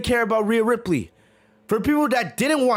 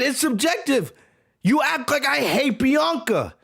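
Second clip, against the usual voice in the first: ordinary talk, nothing marked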